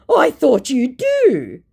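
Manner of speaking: mock scammer voice. Voicing the lost scammer.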